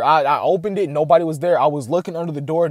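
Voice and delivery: ordinary talk, nothing marked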